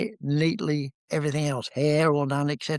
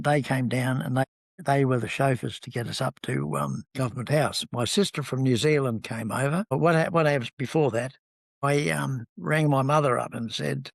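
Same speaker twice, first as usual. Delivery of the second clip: normal talking voice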